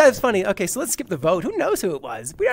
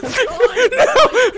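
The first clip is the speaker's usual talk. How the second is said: laughing; 10 semitones higher than usual. Real, not acted. Laughs at being caught.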